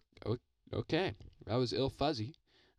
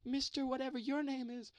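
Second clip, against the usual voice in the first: much higher, strange put-on voice for a debt collector; put-on silly voice. Landlord at the bedroom door demanding his owed money, high put-on voice. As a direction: squeak it out like a cartoon creditor demanding cash. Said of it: "Why, why are you talking like that?".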